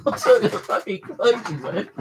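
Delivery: high, laughing disbelief; words breaking up into laughter. Real, not acted. Storyteller amused at the free waistcoat, laughing.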